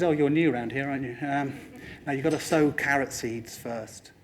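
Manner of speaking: unimpressed Mother Nature. Acted. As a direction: gently set a newcomer straight. Mother Nature answers the shouting farmer.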